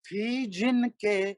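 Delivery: slow melodic chanted Urdu verse; stately nazm recitation. Acted. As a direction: intone each line like a mushaira poet. Recites a manqabat at a gathering.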